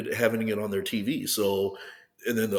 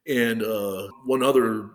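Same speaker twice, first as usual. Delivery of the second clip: ordinary delivery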